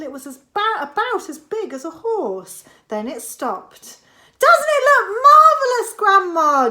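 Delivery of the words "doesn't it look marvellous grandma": high, loud delighted call; high loud boy's shout; George shouting. A narrator's reading. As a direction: shout up in triumph. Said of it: "George shouted".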